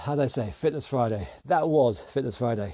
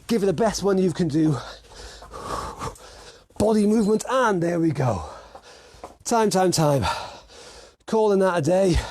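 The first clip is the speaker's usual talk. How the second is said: higher, urging boxing coach voice; workout coaching call-out; 4 semitones higher than usual. Real, not acted. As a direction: drive them through the last round. Calls out drills during final round.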